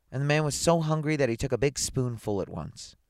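ordinary delivery

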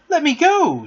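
high, loud, indignant command; ordering. A narrator's reading. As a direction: demand release sharply and loudly. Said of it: "she ordered him".

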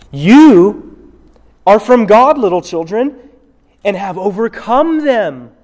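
a preacher reading scripture from the pulpit. Acted. Reads the scripture passage aloud.

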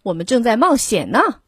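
ordinary delivery, nothing marked

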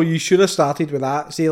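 ordinary talk

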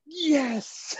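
squeaky high triumphant yes; giddy yes. Retold or imitated. Narrator hugged by Neil, high squeaky triumphant yes. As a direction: squeal it like a fanboy. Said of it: "I was like".

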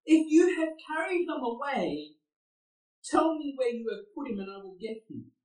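high, pleading cry for the missing body; high desperate voice of a grieving woman; desperate plea. Retold or imitated. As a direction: beg the gardener, voice climbing high. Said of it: "She's desperate for Jesus".

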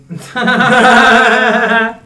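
high, loud burst of laughter. Real, not acted. Friends cracking up over dating joke, loud laughter.

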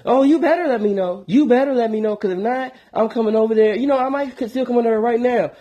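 high, insistent caring woman; worried woman friend. Retold or imitated. Female friend on the phone insisting he see a doctor, high and fussing. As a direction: fuss over him like a worried girlfriend.

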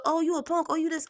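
high-pitched mocking jeer; mock taunt. Acting out others taunting her.

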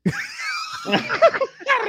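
very high shrieking laugh; loud shrieking laugh. Real, not acted. Burst of laughter.

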